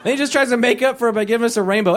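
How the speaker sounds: normal talking voice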